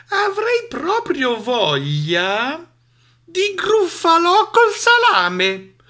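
high mouse voice, slowing and building; confident threatening. Acted. Mouse threatens to eat the monster, gloating. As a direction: draw out the menace to a loud finish.

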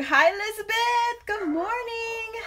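high, drawn-out hello; bright greeting. Real, not acted. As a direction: sing out a cheery good morning. Greeting a viewer by name.

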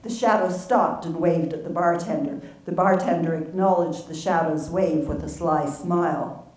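ordinary talk

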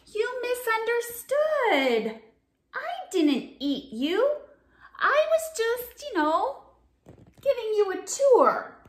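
high, wheedling excuse; shark pleading innocence. Acted. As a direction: talk your way out of it sweetly.

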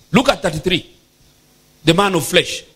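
ordinary delivery

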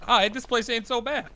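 ordinary talk, nothing marked

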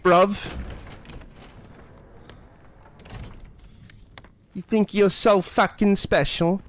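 hammed-up Cockney threats, high and drawn out; exaggerated high-pitched mock Cockney; Cockney gangster James Sunderland. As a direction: pick a fight in a bad Cockney accent. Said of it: "James Sunderland is a Cockney gangster".

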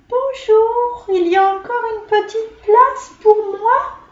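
a tiny polite insect asking sweetly; tiny flea. A narrator's reading.

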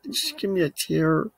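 high, strained plea; pleading for tears. Real, not acted. Vlogger begging himself for a tear, high whine. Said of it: "Oh, I really wanna cry".

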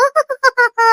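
high-pitched mocking laugh; put-on giggle in a high pitch; mimicked laugh. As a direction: imitate his silly hu-hu laughing. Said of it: "ಅದೇನೋ ಹು ಹು ಹು ಅನ್ನೋದು".

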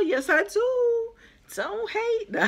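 very high, drawn-out playful boast; playful bragging. Pretend. Answering boyfriend about her friends from Jamaica, playfully bragging. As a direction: tease him in a high, gloating singsong. Teasing imagined reply to boyfriend.

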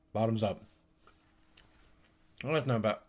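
talking with candy in mouth; words round a sour candy; eating sour candy. Real, not acted. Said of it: "another sickeningly sour candy".

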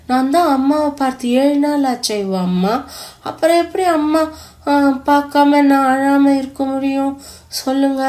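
put-on a little boy pleading through sobs; sobbing voice. A narrator's reading. Little boy asks Yuva through sobs, sobbing voice. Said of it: "விசும்பிய குரலில்".

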